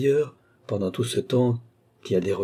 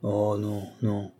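softer, defeated murmur; discouraged murmur; 5 dB quieter, slower than usual. A narrator's reading. Rider giving up on his own power, murmured. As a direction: let the hope drain out quietly. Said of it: "murmura-t-il avec découragement".

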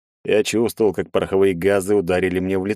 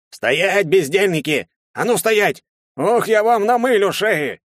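put-on much higher, louder old man's yell; old policeman shouting; 13 semitones higher, 3 dB louder, slower than usual. A narrator's reading. Old constable yells after fleeing students. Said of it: "старческий голос городового прокричал".

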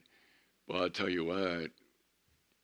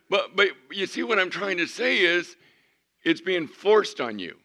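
higher and louder, driving the point home; preaching at full force; 10 semitones higher, 10 dB louder than usual. Real, not acted. Preacher insisting to congregation that praise is forced, loudly. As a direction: hammer it out to the crowd.

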